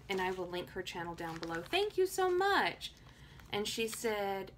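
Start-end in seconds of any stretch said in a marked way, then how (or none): 1.7-2.9 high, loud burst of thanks; excited thanks. Real, not acted. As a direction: gush your thanks up high. Thanking viewer for sent mail.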